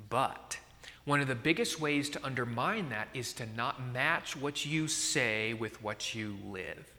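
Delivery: ordinary delivery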